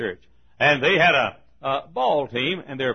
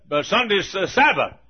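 higher-pitched lightly voiced mother; raised-pitch quote of mother; mother insisting; 6 semitones higher, 4 dB louder than usual. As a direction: imitate a mother's firm objection. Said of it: "she said".

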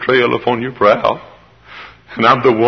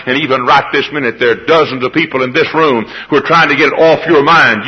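normal talking voice

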